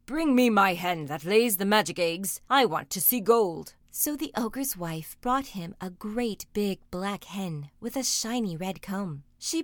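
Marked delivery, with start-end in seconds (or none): 0.0-3.7 loud, demanding giant voice; storybook giant calling for his treasure; commanding ogre. A narrator's reading. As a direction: order it like a booming ogre. Ogre bellows orders at wife.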